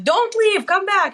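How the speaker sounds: high, loud yell to come back; retold scream. Husband yelling after his wife, high and loud. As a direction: shout after her as she walks out. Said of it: "screams at me".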